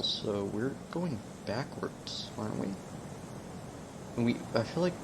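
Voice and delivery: half under his breath, working it out; muttering to self. Real, not acted. Muttering to himself while lost.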